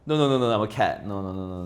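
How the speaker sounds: ordinary talk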